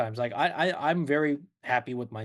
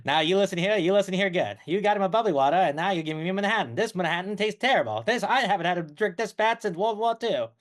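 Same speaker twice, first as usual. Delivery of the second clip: fifties movie talk in a Mid-Atlantic accent; Atlantic talkie accent; 3 semitones higher than usual. Shown as a demonstration. Customer complaining about a bad Manhattan, in Atlantic talkie accent. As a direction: scold in old-film Mid-Atlantic style. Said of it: "do you know the Atlantic talkie accent?".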